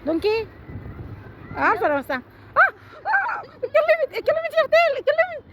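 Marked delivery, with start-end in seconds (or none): 3.4-5.4 gleeful high call-out; triumphant high taunt at the sister who spoke. Real, not acted. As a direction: sing it out high, catching her talking. Kids' silence game, calling out a loser.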